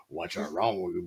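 imitated muttering cartoon scolding; Hillbilly Bear. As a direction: mumble it like a cartoon hillbilly dad. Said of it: "she goes into the Hillbilly Bear dad".

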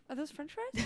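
high, tentative dog voice; curious dog. Acted. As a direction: play a dog who has just noticed food. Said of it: "He'd be like".

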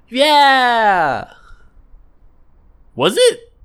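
high, loud sarcastic doubt; sarcastic mock reply. Answering the cheery TV host back, high and sarcastic. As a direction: drag it out in mock disbelief.

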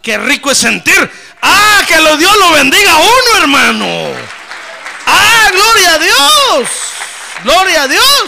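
loud, high, drawn-out praise; preaching at full force. Real, not acted. Pastor to congregation, full-force ecstatic praise.

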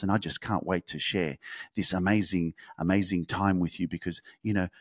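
soft, low-energy podcast host parody; mock dull podcast host. Host mocks a dull podcaster to his audience, soft and flat. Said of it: "if you were talking like that all the time".

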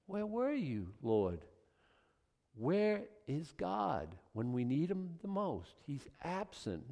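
soft, slow questioning of God; understated, drawn-out complaint; mild accusation. Acted. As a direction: quietly reproach an absent God. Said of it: "there's a little bit of an accusation there".

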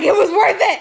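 high, loud line through laughter; mock triumphant. Man pleased he saw the picture, mock triumphant. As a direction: crow it as a laughing imitation. Imitating his smug reply.

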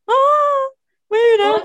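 high, loud delighted yelp; excited cheer. Real, not acted. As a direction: burst out with joy. Excited about listener's growth.

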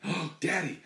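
put-on shocked child catching a swear. Daughter hears dad cuss, shocked high cry.

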